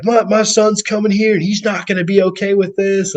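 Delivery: high-pitched mock warning; retold freaking out. Imitating the lady threatening the crew with her son, high mock freak-out. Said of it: "freaking out".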